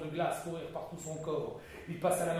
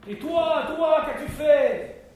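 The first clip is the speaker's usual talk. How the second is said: much higher, louder, slow insistent question; urgent demand; 11 semitones higher, 12 dB louder, much slower than usual. Acted. Duke pressing Ben on what he has done, loud and high. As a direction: drag the answer out of him.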